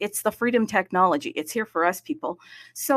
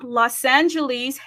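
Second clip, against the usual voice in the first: normal talking voice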